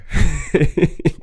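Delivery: high giggle. Real, not acted. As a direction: laugh it off. Laugh at not knowing dinner.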